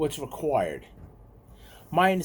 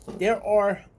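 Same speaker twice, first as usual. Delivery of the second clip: usual speaking voice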